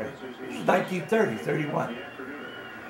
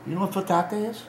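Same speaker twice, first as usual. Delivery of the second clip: ordinary delivery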